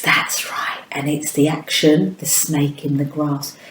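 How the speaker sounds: soft voiceless whisper; hushed teacher aside. Acted. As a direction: whisper it to the class.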